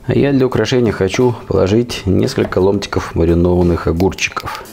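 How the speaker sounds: ordinary talk, nothing marked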